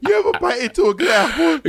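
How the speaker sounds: high, hammed-up tearful rapture; exaggerated weepy gushing; mock crying. Host mimicking co-host gushing over an apple, mock crying. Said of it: "She was, like, crying".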